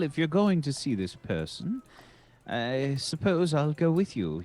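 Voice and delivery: usual speaking voice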